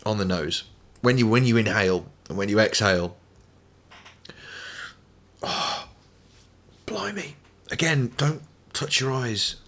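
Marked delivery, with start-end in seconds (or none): 5.4-9.6 hushed, slow, hurting whisper; pained whisper. Real, not acted. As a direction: breathe the words out through the sting. Spice in the nose and eyes.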